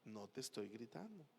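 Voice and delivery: very hushed, low restrained voice; speaking softly. Acted. Husband insisting to his wife he isn't yelling, very softly. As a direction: barely voice it, low and controlled. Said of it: "Y le hables suavecito".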